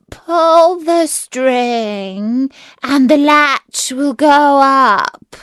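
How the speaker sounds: very slow, high, loud disguised wolf; wolf faking a softened voice. A narrator's reading. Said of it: "softening his voice as much as he could".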